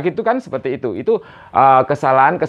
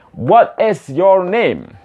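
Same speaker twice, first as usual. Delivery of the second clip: slow, drawn-out WHAT IS YOUR NAME, higher pitched; slow model reading; 4 semitones higher, much slower than usual. Shown as a demonstration. Teacher models the question for learners to repeat, slowly. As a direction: say it word by word for repetition. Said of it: "Repeat after me semuanya".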